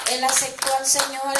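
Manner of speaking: real joyful proclamation; joyful outburst. Real, not acted. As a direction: proclaim it like a victory. Joyful testimony climax.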